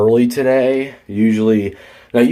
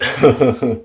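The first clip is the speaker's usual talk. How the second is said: mock tough-guy chuckle; wannabe tough-guy laugh. Retelling how a coworker answered his challenge, mock tough-guy laugh. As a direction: imitate a cocky, put-on laugh. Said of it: "like a wannabe tough guy".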